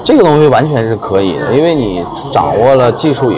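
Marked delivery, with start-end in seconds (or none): none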